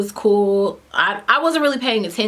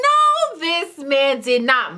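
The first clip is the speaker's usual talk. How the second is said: scandalized disbelief; 4 semitones higher, much slower than usual. Retold or imitated. Disbelief at date's complaint.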